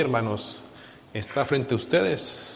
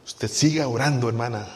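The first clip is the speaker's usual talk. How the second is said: ordinary talk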